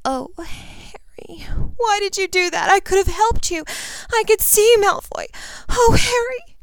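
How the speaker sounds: very high, anguished pleading; distraught girl. A narrator's reading. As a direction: open on a breathy gasp, build to a wail. Ginny grieves over wounded Harry.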